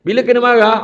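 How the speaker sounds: usual speaking voice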